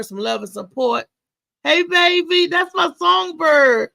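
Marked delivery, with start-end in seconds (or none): 1.6-3.9 gushing over the songbird; delighted shout-out. Real, not acted. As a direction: greet your favourite singer with open delight. Host greets the songbird on air.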